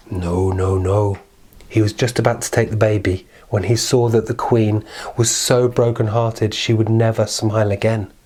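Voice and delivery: ordinary delivery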